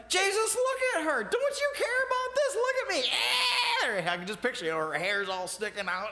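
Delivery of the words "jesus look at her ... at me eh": high, loud frazzled shriek at Jesus; shrill it out, a busy woman at her wits' end; frantic complaint. Retold or imitated. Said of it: "burst out into the living room and said".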